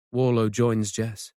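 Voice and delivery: ordinary delivery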